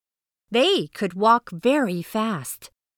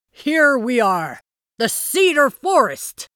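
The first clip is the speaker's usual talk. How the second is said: excited arrival; 4 semitones higher, 5 dB louder than usual. A narrator's reading. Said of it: "said Gilgamesh".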